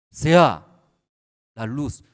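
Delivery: loud, ringing proclamation as God; booming divine command. Acted. Pastor voicing God's command to the congregation, booming. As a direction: call it out like God speaking light into being.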